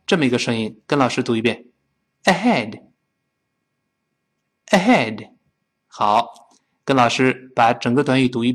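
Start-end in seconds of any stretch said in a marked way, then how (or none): 2.2-5.3 "Ahead" with a light airy h; open-throat H. Shown as a demonstration. Teacher has students repeat, "Ahead" with open-throat h. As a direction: let air flow freely on the h of "Ahead". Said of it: "注意喉咙完全打开".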